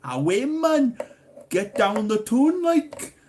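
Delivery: loud, high-pitched generic Geordie; Geordie accent. Acted. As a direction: call it out in broad Geordie. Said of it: "talking Geordie".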